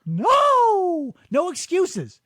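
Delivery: high whiny denial; mock caught kid. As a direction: squeak out a childish no. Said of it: "a child getting caught with cookies".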